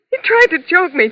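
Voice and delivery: rising in panic; frightened. Acted. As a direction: panicky recall of being choked. Reliving the attack.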